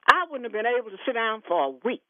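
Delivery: usual speaking voice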